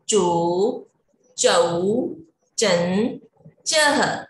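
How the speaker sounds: slow paced teacher chant, each syllable set apart; syllable drill recitation. Shown as a demonstration. Teacher leading children through the क row, slow recitation. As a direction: recite the vowel chart for little kids.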